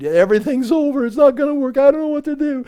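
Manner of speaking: rising, high-pitched panic; hammed-up helpless whine; mock desperate. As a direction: climb into exaggerated despair. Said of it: "get so desperate".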